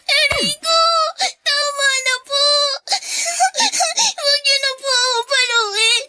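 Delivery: tearful begging. Acted. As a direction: beg them to stop through tears. Girl begs not to be hit.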